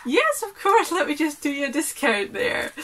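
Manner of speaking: high, loud, eager yes to a customer; chirpy, keen to please the buyer; eager shopkeeper. Retold or imitated. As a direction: stallholder happily agreeing to a big order, high and loud. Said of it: "And I was like".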